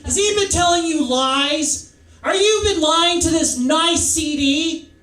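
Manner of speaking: high, loud New York mom; hammed-up, high-pitched maternal scolding; loud scolding mother. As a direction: interrogate like an outraged mother. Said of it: "She sounds just like that".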